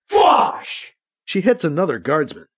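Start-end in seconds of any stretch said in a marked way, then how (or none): 0.1-1.0 high, airy energy-rifle whoosh; imitated blaster shot. A narrator's reading. Katie hits another guardsman, a high imitated blast.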